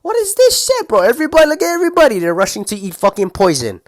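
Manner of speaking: very high, loud, fast outraged disbelief. Retold or imitated. Seeing the Chick-fil-A line, squealing in disbelief. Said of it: "I was like, Bro".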